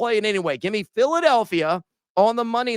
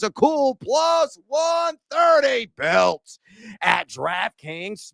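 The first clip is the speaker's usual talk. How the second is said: hype call; 4 semitones higher, much slower than usual. Acted. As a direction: drag out the number like a game-show host. Announcing the parlay's odds.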